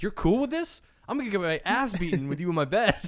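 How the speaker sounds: high, loud panicky disbelief; half-shouted mock panic at a sleeping girlfriend; panicked inner voice.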